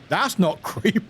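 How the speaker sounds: normal talking voice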